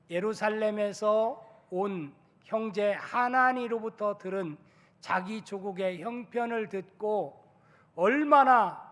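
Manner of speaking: normal talking voice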